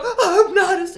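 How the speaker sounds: mock offense. Mocking offended pronoun reaction.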